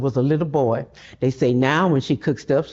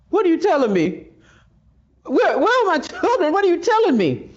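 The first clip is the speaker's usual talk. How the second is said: anguished disbelief; 13 semitones higher than usual. Acted. Mother learns children are sold.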